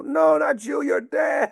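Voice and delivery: very high, exasperated mock call; emphatic correction. Passers-by correcting the son, high exclaimed dismissal. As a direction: imitate fans brushing him off. People dismissing him, calling out.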